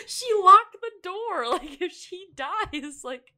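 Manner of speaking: high, loud laughing alarm; laughing panic. Retold or imitated. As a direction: giggle it out in mock panic. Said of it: "I was like".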